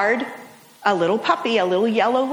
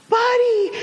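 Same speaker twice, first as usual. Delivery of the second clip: much higher, louder cry of the dog's name; joyful call; 12 semitones higher, 3 dB louder than usual. Retold or imitated. Owner spots her puppy coming home, high joyful cry. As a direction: call out as you see him running home. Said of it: "and she goes".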